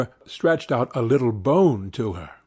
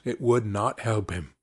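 usual speaking voice

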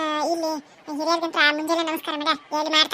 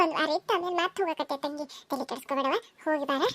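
soft farewell; 2 semitones lower, 8 dB quieter than usual. Acted. Mother softly sending her daughter off to her husband's house. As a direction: let the goodbye sink soft.

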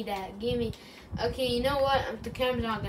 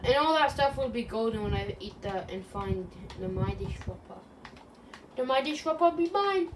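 ordinary talk, nothing marked

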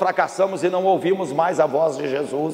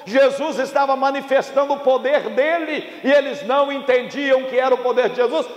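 high, projected pulpit delivery; impassioned preaching; 8 semitones higher than usual. Real, not acted. Preacher warns congregation about failure, impassioned preaching. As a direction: preach it out with full conviction.

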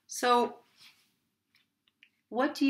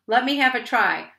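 lightly voiced mouse, higher and louder; squeaky little mouse; 4 semitones higher, 6 dB louder than usual. A narrator's reading. Little mouse offers to help, mild squeak. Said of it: "squeaked the little mouse".

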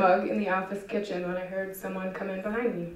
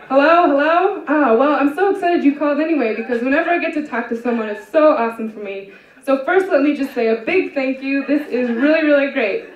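higher, louder, bubbly host chatter; eager chatter, higher and louder; over-eager cheer; 4 semitones higher, 6 dB louder than usual. Acted. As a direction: gush at the silent caller. Chirpy host talking to silent caller.

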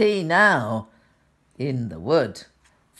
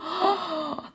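frightened gasp; 5 dB quieter than usual. Acted. Bear frightened by owl.

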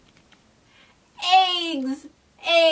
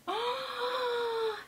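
delighted gasp; 9 semitones higher, 11 dB quieter than usual. Pretend. Duck reveal, delighted.

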